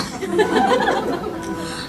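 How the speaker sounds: soft, high trailing giggle; amused laugh. Real, not acted. Speaker amused by visitors who never gave up, laughing. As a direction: giggle quietly and breathlessly.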